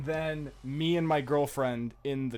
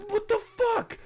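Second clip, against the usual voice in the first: very high-pitched, disbelieving yelp; mock outraged squeal. Retold outrage at girlfriend's cold reply.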